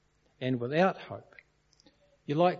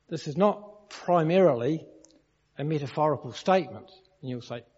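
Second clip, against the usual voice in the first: ordinary delivery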